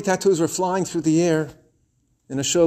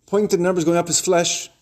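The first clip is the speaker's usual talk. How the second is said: ordinary delivery, nothing marked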